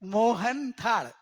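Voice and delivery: Mohanthal said slowly, every syllable drawn out; slow, deliberate mohanthal; word drawn out. Shown as a demonstration. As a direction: stretch mohanthal out to show its parts. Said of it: "બહુ સરસ શબ્દ છે એનો".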